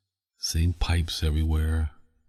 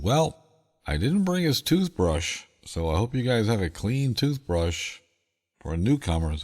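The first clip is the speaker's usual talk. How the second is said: a mock-serious dad, pitched well higher; dad's voice; 7 semitones higher than usual. Dad tells the workers he forgot the toothbrush, pitched higher. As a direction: play the dad deadpan, voice up high.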